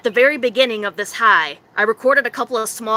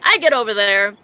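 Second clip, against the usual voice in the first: ordinary talk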